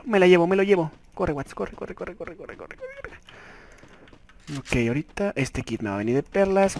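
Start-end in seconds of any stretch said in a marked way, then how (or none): 1.1-3.2 very soft, repeated urging to run; tiny, hushed chant of urgency. Real, not acted. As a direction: mutter it under your breath, over and over. Urges himself to run.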